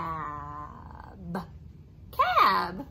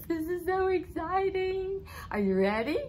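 excited; 8 semitones higher, 6 dB louder than usual. Acted. Teacher hyping the children.

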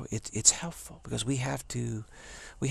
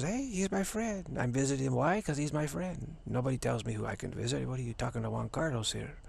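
Juan Carlos; 3 semitones higher than usual. Acted. Celebrity friend insisting he visits whom he likes, cocky. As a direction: protest proudly as the untouchable friend. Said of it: "Juan Carlos says".

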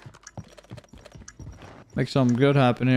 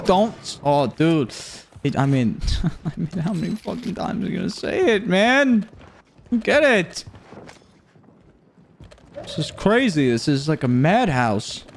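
ordinary talk

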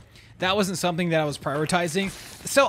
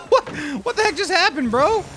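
loud high laughing outburst; laughing disbelief; 12 semitones higher, 6 dB louder than usual. Real, not acted. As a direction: laugh through the words, stunned.

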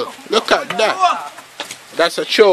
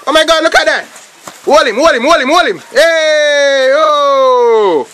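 excited match reaction; 4 semitones higher than usual. Real, not acted. Reacting to live play.

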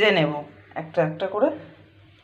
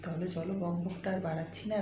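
softer hushed sign-off line; speaking softly; 3 semitones lower, 10 dB quieter than usual. Real, not acted. Hushed sign-off while Poko is asleep, speaking softly. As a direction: say it quietly, hushed. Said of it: "আমি আস্তে আস্তে কথা বলছি".

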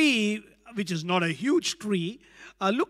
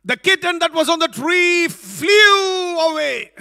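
loud, higher punchline; dramatic climax; 8 semitones higher, 9 dB louder than usual. A narrator's reading. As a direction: throw the kitten skyward with your voice. Climax of funny story.

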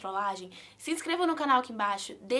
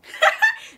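sudden shrieking laugh, very high and loud; can't hold in the laugh at the prank; burst of laughter; 14 dB louder than usual. Real, not acted. Daughter shrieks with laughter over her mom's reply.

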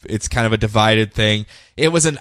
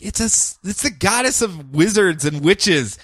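smug explaining; 7 semitones higher than usual. Acted. Condescending explanation in character.

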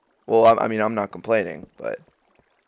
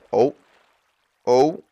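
ordinary delivery

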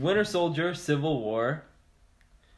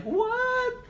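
mock amazement. Real, not acted. Amazed at the suits.

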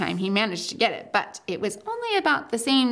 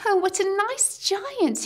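put-on much higher little girl voice; Lucy's voice; 11 semitones higher than usual. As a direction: pipe up like an eager little girl. Lucy voiced, high.

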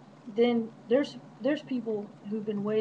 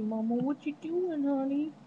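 put-on higher, childlike dog voice; voicing the dog; 6 semitones higher than usual. Owner voicing her dog talking back to her, high and cute. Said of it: "She said".

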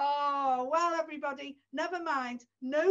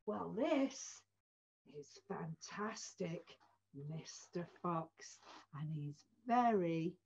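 low, soft secretive voice; low, soft hushed reveal of the fox; 8 semitones lower, 6 dB quieter than usual. Acted. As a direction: draw them in, low and soft.

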